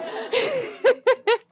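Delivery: high, pulsing laugh; real bubbling high giggle. Real, not acted. Teacher amused by audience remark, laughing.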